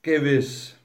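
comfortable, smiling agreement; unruffled, easy assent; smugly at ease. A narrator's reading. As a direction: agree placidly, with a smile. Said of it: "nickte Wolf behaglich lächelnd".

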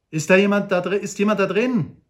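calling out. Acted. Old Shatterhand calls into the cave, loud call.

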